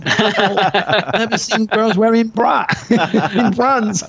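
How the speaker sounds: laughing through the words, slow; amused laughing disbelief. Real, not acted. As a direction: giggle it out in disbelief. Laughing at the culture shock.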